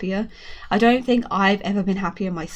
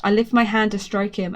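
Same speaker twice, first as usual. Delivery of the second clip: plain reading voice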